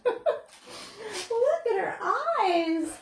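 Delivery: put-on high and drawn out, a child in awe; little girl marveling. Girl admiring the dog's eyes, high and slow. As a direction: stretch it slowly like an amazed kid. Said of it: "the girl said".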